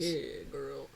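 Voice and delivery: low, lazy deep-voiced imitation; impression of co-host. Co-host imitating her partner, mock deep voice. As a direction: tease with a low smooth drawl. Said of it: "that's my impression of Sean".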